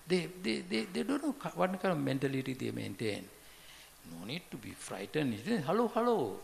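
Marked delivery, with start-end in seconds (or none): 5.6-6.4 cheerful greeting. Shown as a demonstration. Advising friendly greetings.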